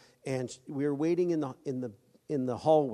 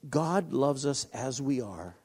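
usual speaking voice

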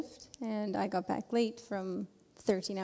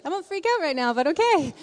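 high, panicky but trying to agree; about to freak out; 8 semitones higher, 6 dB louder, faster than usual. Retold or imitated. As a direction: panic held barely in check. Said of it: "a twitch in his face".

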